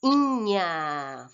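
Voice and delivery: "Inha" drawn out on its own; "Inha" said slowly as an isolated ending; rhyme-ending demo. Shown as a demonstration. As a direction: stretch the first vowel of "Inha" to show the rhyme sound. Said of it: "tem que terminar com o mesmo som".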